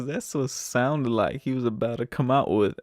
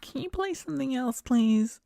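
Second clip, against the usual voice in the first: lady passenger; 13 semitones higher than usual. Retold or imitated. Lady passenger asking for another song, imitated in a high voice. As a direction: put on a high-pitched pleading woman. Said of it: "the lady was just like".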